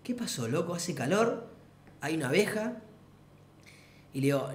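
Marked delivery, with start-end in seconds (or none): 0.0-2.8 soft, groggy imitation; hushed mock sleepy voice; just woken up. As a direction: mumble it like someone newly awake. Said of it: "como recién despierto y como".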